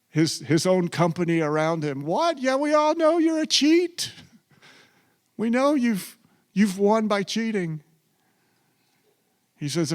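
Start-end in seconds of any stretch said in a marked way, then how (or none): 2.0-7.8 high, scoffing jeer of the crowd; mocking crowd. The tax collector's company jeering at his pledge, high and scoffing. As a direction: sneer at him like his old cronies.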